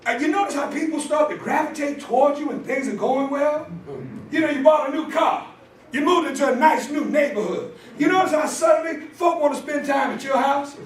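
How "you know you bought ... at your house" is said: raised, driving preacher cadence; preaching. Acted. Preacher telling the congregation how friends come in good times, preaching. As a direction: build the sermon phrase by phrase.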